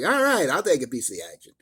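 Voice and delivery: put-on quick, cocky kid gangster voice; kid agreeing. Kid takes Kirk's offer, a quick cocky street-kid voice. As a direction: snap up the deal like a young hustler, fast. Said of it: "Kid's like".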